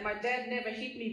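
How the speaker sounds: ordinary delivery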